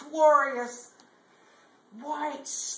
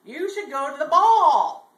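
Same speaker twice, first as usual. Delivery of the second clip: mysterious voice; 4 semitones higher, 9 dB louder than usual. Acted. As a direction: call it out like a mystery voice, stretching the last word. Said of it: "he heard this voice say".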